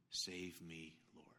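desperate whisper; whispered plea. Acted. Sinking Peter begging Jesus, whispered plea.

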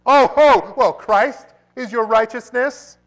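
very loud, high mocking devil; preacher voicing Satan jeering at the believer; Satan's scoffing. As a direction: jeer at the dying man's faith. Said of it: "Satan says".

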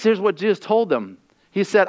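ordinary delivery

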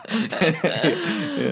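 usual speaking voice

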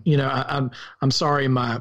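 ordinary talk

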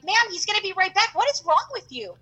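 mock bewildered protest to the shopkeeper; incredulous; exasperated disbelief. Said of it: "And I'm like".

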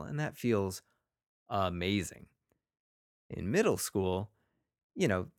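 ordinary delivery

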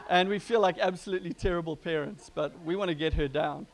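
ordinary talk, nothing marked

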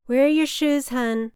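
a hint of a kindly paramedic; coaxing medic. A narrator's reading. EMT gently coaxing drunk mother.

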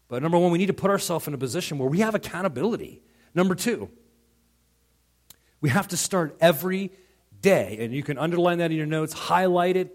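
preaching at full force. Acted. Pastor rallies his church to daily habits, preaching loud and high. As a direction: hammer the points home to the congregation.

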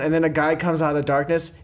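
ordinary talk

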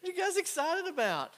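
high, loud mock-innocent question. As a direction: play dumb with a high, loud voice.

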